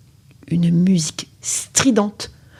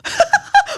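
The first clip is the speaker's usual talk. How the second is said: high, falling witch's cackle; a short wicked burst of laughter; evil cackle. Acted. The sorceress cackles at her own idea, high and short. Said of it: "méchant sourire".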